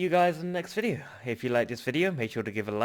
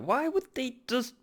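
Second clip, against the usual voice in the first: angry; 10 semitones higher than usual. Real, not acted. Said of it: "I'm sorry I'm getting angry".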